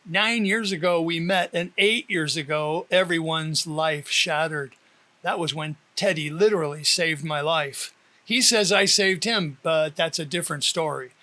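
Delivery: plain reading voice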